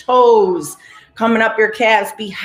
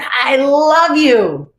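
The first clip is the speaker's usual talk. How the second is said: slow, high, louder I love you, stretched out; heartfelt affirmation; 6 semitones higher, 5 dB louder, much slower than usual. Shown as a demonstration. Leading the group in self-love, a slow heartfelt I love you. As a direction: pour feeling into the words I love you. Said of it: "Repeat after me".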